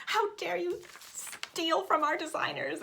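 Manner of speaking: high, drawn-out mock indignation; hammed-up outrage, slow and high; mock dramatic. Stitcher pretending to scold the chart copier, mock dramatic. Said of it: "I don't need to be that dramatic".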